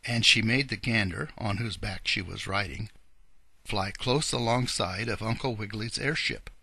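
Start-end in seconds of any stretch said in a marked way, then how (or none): none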